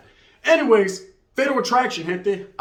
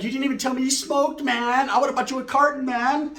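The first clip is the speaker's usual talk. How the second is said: put-on girl's voice; 8 semitones higher than usual. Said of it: "She was like".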